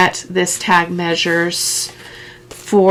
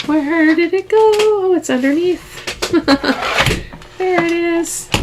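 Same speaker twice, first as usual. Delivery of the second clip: surprised, much higher; 11 semitones higher than usual. Real, not acted. Crafter finding her missing die-cut plate, high surprised voice. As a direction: pitch jumps way up, a little oh of surprise. Hunting for lost plate, finds it.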